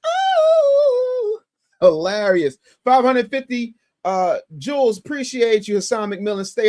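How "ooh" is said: a high squeal of delight; delighted high ooh. Real, not acted. Reacts to clip.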